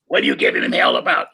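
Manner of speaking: scolding Art. Retold or imitated. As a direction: growl it back at B B. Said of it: "Art came in and said".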